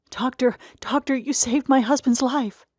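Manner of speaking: high, effusive grateful woman; grateful wife voice. Acted. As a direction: gush thanks to the doctor. Grateful wife thanks the doctor.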